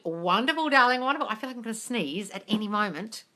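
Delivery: ordinary talk, nothing marked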